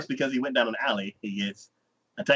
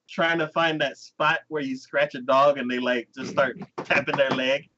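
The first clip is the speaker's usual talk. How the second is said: normal talking voice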